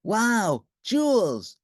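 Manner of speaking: loud, delighted gasp of joy; thrilled by a gift; excited exclaim. A narrator's reading. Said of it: "exclaimed the fair Lucretia".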